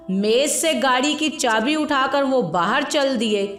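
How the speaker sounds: usual speaking voice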